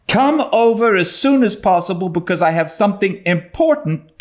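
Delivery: put-on high, eager girl's voice, drawing out the counts; a girl bursting with her secret code; excited young Anne. A narrator's reading. Anne explaining signals.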